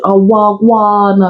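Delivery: long, stretched mock Jamaican-patois greeting. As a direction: imitate someone badly faking patois.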